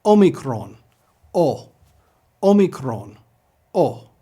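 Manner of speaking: "Omicron" with o as in or, then a lone "O"; no w glide on any o of "omicron"; pure Greek O. Shown as a demonstration. Teacher leads repetition, unrounded-ending O. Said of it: "pronounce this O as you do for the English word or".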